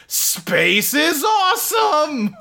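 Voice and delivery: high, slow, shouted hero cry; mock yelled catchphrase. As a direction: yell it with arms up. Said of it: "throws his arms up and yells".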